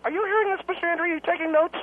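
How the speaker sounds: ordinary delivery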